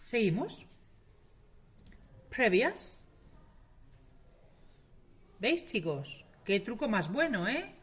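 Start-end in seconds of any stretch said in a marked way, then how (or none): 2.3-3.1 "Previas" ending in i plus schwa and S. Shown as a demonstration. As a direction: say "Previas" loudly with the ias ending. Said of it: "tiende a ser ia".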